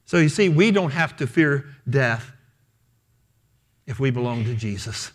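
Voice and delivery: ordinary talk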